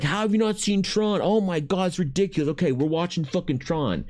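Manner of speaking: high, exasperated disbelief; mock outraged fan. Friend at wing night aghast his buddy never saw Tron, high mock outrage. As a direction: play the friend who can't believe it.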